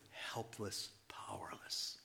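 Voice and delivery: whispered. Acted. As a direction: let the words fade to a whisper. Whispered descriptive words.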